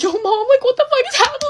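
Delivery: very high-pitched panicked squeal, acting out the call; tearful panic. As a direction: imitate a hysterical, squeaky plea. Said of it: "I'm also crying".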